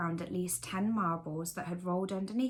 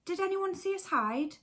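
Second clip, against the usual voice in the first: high teddy voice; a toy calling out from hiding; Bramwell Brown voice; 12 semitones higher than usual. A narrator's reading.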